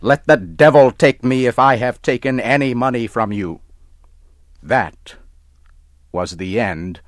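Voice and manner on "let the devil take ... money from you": fast, loud and higher; angry shout. Acted. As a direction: shout it in a rage. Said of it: "Tom got angry and started to shout".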